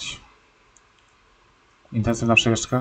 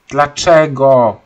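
slow, loud drawn-out why; outraged slow protest at the game; indignant why; 7 dB louder, much slower than usual. Real, not acted. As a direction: stretch it out in disbelief.

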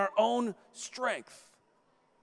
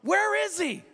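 put-on little boy; 9 semitones higher than usual. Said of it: "he goes".

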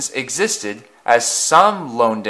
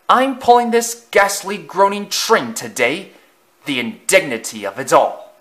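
higher, pompous complaint; haughty disgust; 6 semitones higher, 3 dB louder than usual. A narrator's reading. Afton scorning his coaches, haughty disgust. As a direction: sniff at the indignity. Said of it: "he hissed angrily".

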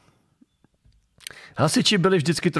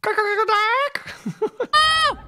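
high, cackling screech; rapid high cackle ending in a squawk; mock squawk. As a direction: squawk like a silly bird in parody. Silly screeched noise mocking hecklers.

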